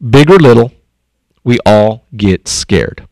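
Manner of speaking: put-on gentle wise old owl; wise owl advice. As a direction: say it like a kind wise teacher. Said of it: "he gave this word of advice".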